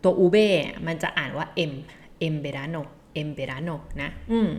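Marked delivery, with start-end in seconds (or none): 1.6-3.8 "em" then "verano", run as "em-berano"; n as m before V. Shown as a demonstration. Teacher explaining n before V, sounded as m. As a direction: close the lips for m in "En verano". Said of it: "ถ้าสมมติมันตามด้วยตัว V".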